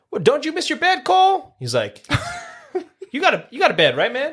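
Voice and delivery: high, hammed-up soldier voice; mock the soldier's cheesy line; imitating a soldier's cheesy line in a hammed-up high voice; mock character voice. Impersonating a movie character.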